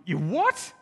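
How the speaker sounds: loud, rising startled question; acted shock. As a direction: play a boss aghast at the news. Boss hears herds are stolen.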